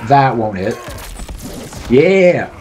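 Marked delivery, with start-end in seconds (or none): 1.9-2.5 excited cheer. Real, not acted. Cheering during fight.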